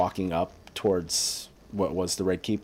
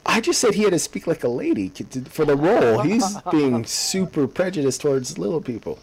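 excited protest; 7 semitones higher, 4 dB louder than usual. Real, not acted. Mock accusation amid podcast banter.

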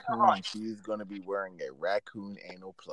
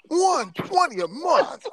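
very high, loud, drawn-out ad shout; hyped commercial announcer; 5 dB louder, slower than usual. Pretend. Repeating the price for friends, over-the-top announcer shout. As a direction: blast the price like a car-ad pitchman. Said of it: "how some of the commercials say".

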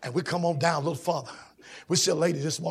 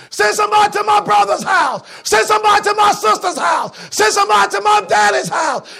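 desperate holler from hell; 14 dB louder than usual. Acted. Damned man in hell hollering to the preachers. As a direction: wail it like a lost soul begging. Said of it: "that rich man began to holler".

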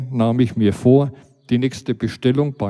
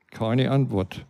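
ordinary delivery

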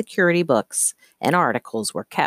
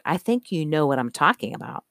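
ordinary delivery, nothing marked

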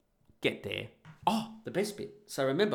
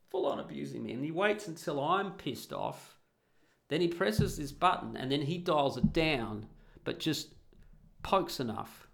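ordinary talk, nothing marked

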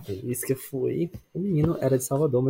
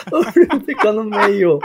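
laughing; 4 semitones higher, 4 dB louder, slower than usual. Real, not acted. Laughing retelling cousin seated middle.

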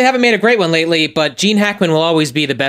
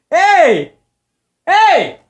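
sharp, high shout to stop the cats; 11 semitones higher, 4 dB louder than usual. Real, not acted. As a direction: yell out to break up a fight. Yelling at fighting cats.

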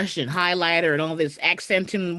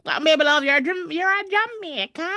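higher-pitched attempt at a Jamaican accent; playful, clumsy Jamaican accent; bad Jamaican accent; 7 semitones higher than usual. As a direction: try a Jamaican accent, not very well. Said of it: "I can't do the Jamaican accent".

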